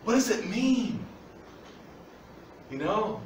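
ordinary talk

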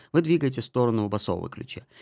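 ordinary talk, nothing marked

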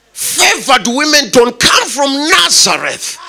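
exasperated high protest, building to a near shout; incredulous outburst. Retold or imitated. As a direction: act out a husband's rising disbelief, pitched well up. Acted Joseph's incredulous outburst at Mary.

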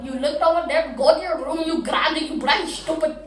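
loud, high-pitched accusations piling up; furious tirade. Retold or imitated. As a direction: berate the liar without letting up. Mother explodes at daughter's excuse.

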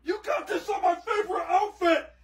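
high, tearful complaint; upset wail. Real, not acted. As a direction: whine at the grandmother in outrage. Pranked grandson complains about clothes.

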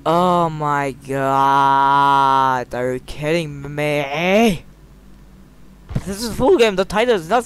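stunned disbelief. Real, not acted. Gamer amazed the full game is here, slow stunned disbelief. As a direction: stretch every word in shock.

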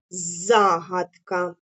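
very slow, each syllable stretched; dictation drawl. Shown as a demonstration. Teacher dictating a word to the class. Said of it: "протягуючи кожний склад".

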